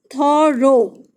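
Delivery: थॉरो said as thuh-ro, with no gh sound; model pronunciation. Shown as a demonstration. Teacher showing pupils how thorough is read, clearly. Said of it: "इसको पढ़ते हैं".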